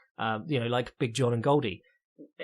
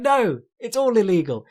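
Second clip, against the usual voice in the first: exasperated retort; 7 semitones higher, 5 dB louder than usual. Retold or imitated. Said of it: "And you're like".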